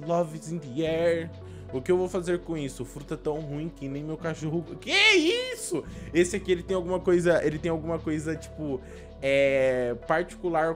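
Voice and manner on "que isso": high, slow astonished gasp of a question; surprised. Real, not acted. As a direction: stretch it out in disbelief. Reacts to a strange fruit.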